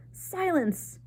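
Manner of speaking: high roaring command; head mouse silencing the assembly, roaring; roared. A narrator's reading. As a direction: roar it down at the crowd.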